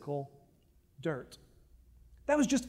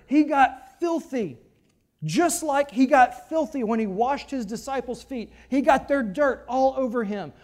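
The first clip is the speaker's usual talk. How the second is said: ordinary talk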